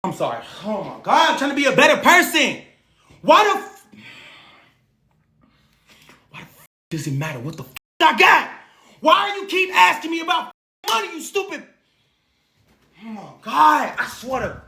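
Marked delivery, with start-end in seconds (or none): 3.2-11.6 sudden furious outburst at the comments; real anger. Real, not acted. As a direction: snap at the viewers in real frustration. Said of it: "'cause I'm so mad right now".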